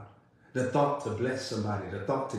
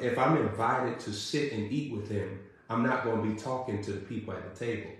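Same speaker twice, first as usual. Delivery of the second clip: usual speaking voice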